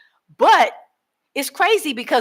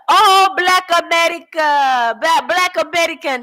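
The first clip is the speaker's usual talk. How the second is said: locals exclaiming; 8 semitones higher, 5 dB louder than usual. Retold or imitated. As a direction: stretch the Oh with delight. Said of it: "And they say".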